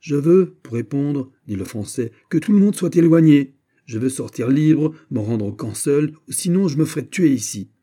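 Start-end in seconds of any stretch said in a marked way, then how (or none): 0.0-1.3 loud, higher declaration; defiant demand. Acted. Besieged Frenchman answers the officer, defiant. 2.3-7.7 raised, higher voice laying down terms; defiant demand. Acted. Besieged Frenchman dictates his terms to soldiers, defiant.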